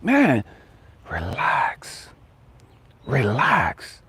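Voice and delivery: fading to a soft whisper on relax; God calming him. Retold or imitated. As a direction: soothe him down until it's a whisper. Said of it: "and God was like".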